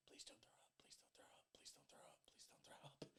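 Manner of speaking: barely audible pleading whisper; tiny, desperate muttered mantra under the breath; whispered self pep talk. Retold or imitated. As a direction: whisper it to yourself to hold it down. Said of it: "Just pep talking myself".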